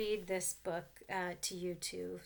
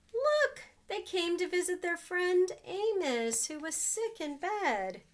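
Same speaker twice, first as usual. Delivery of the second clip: put-on reading to children; 3 semitones higher, 3 dB louder than usual. A narrator's reading. Reads picture book to children.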